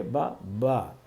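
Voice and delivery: با soft and quiet from relaxed lips; soft repeated با; gentle ba. Shown as a demonstration. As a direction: let با come out softly. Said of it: "نرمی سے ادا ہو".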